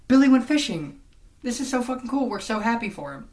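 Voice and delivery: high, loud, gushing parent voice; mock proud parent. A parent gushing about Billy's fishing, high and loud. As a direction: burst with over-the-top pride.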